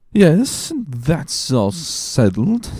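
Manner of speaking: put-on high, slow, slurring drunk drawl; drunken Caderousse. Drunk Caderousse echoing Danglars, slow and slurred. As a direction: drag the words out like a tipsy man.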